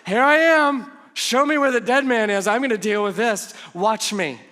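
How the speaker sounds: loud, high, mock heroic; hero's entrance. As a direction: announce yourself like a hero arriving. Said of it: "like a hero has arrived".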